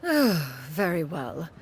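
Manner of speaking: resigned sigh. Acted. Reluctant agreement after a sigh.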